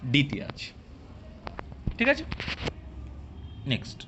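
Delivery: ordinary talk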